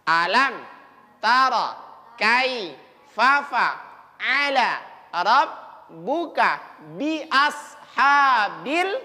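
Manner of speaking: slow, careful recitation; slowly. Shown as a demonstration. Teacher reciting slowly for children at home. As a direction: spell it out slowly, word by word. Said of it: "Coba pelan-pelan".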